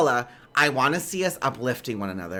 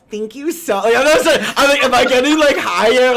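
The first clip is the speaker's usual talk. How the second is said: voice creeping higher; 9 semitones higher, 9 dB louder than usual. Retold or imitated. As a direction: voice rising up high and loud, charmed by her accent. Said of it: "Like, I can't tell".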